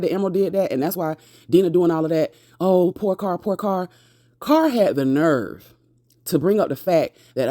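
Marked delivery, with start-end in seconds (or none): 2.6-3.9 mocking pity. Mocking Dina's sympathy.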